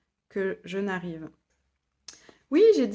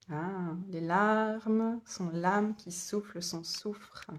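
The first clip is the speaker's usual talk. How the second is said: slow savouring; 4 dB quieter, much slower than usual. Real, not acted. Savouring a quoted line.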